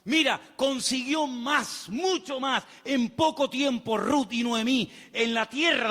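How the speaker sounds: high, strong preaching push; impassioned preaching. Acted. Preacher urging the church to wait on God, impassioned. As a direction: preach it out to the congregation, rising.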